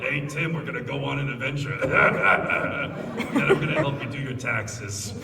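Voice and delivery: raspy Pikachu, laughing through it; smoker Pikachu voice. Retold or imitated. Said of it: "sounding like a smoker".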